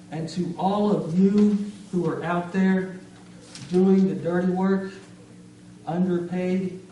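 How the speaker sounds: loud, emphatic spoken-word tribute; proud salute with spaced pauses; rousing poem climax. Acted. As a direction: address the crowd in short, forceful phrases.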